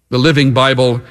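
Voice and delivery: usual speaking voice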